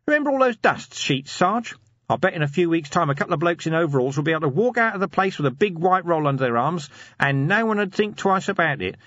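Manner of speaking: high-pitched, eager scheming corporal; eager schemer Nobby. Acted. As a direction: pitch a crafty plan, voice well up. Nobby explains his scheme.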